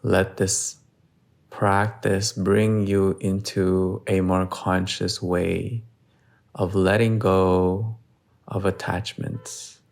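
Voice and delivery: steady yoga instructor, long pauses between phrases; guided meditation. Real, not acted. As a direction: guide them slowly and evenly through each breath. Leads breath hold and meditation.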